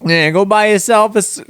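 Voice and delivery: put-on high, botched Italian-American mobster accent; Australian attempt stuck in Italian mobster. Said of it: "stuck in the Italian mobster voice".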